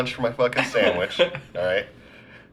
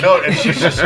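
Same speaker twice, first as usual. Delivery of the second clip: ordinary talk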